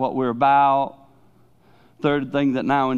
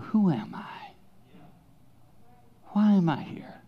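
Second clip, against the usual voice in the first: lightly voiced, higher and softer searching question; lost teen questioning; 6 semitones higher, 4 dB quieter than usual. Preacher voicing a teenager's doubts to the congregation, higher and softer. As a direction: ask it like a confused kid, a touch bewildered. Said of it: "the world is making you go".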